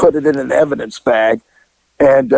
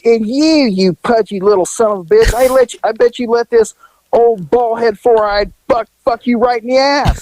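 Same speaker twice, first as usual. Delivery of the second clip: retold cursing; 8 semitones higher than usual. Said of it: "And she goes".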